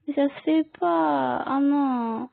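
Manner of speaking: high, put-upon protest that this isn't done; outraged little complaint; indignant whine. Real, not acted. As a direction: whine it like you've been wronged. Complaining nobody came to him.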